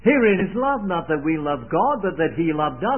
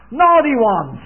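mock child's shout, louder and higher; boy shouting; 6 semitones higher, 9 dB louder than usual. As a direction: blurt it out proudly like the boy. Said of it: "he shouts out".

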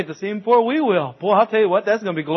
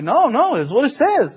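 a high, louder teasing "no, no"; mock insistent baker; 8 semitones higher, 3 dB louder, slower than usual. Baker teasing the crowd of women, insistent. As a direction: play the baker holding firm with a grin.

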